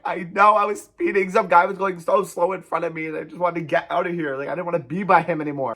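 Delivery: high, loud mock-tearful excuse; retold tearful pleading. Crying driver explains speeding to cop, tearful pleading. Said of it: "I'm in tears".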